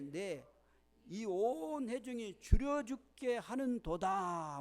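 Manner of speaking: loud, measured congregational Bible reading; scripture read-aloud. Real, not acted. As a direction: lead the verse aloud, drawing out the ending. (크고 또박또박한 성경 봉독)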